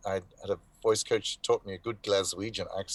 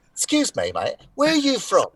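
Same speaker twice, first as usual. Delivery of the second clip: mock stranger's voice pitched way up; high, louder, put-on challenge to a speaker; nosy stranger; 13 semitones higher, 5 dB louder than usual. Stranger challenging him on his origins, high mock voice.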